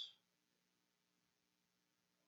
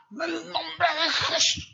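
higher, slow, loud healer's proclamation with a laugh; mock theatrical preacher's cry; showy faith healer; 10 semitones higher, 4 dB louder, slower than usual. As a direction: ham up the faith healer's blessing. Pastor's showy healing shout.

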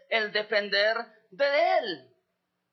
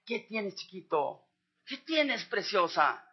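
tender parent voice; 5 semitones lower, 3 dB quieter, slower than usual. Retold or imitated. Parent comforting crying baby.

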